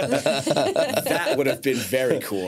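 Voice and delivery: talking through light laughter; amused. Real, not acted. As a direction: play along with the joke, chuckling. Joking reply amid shared laughter.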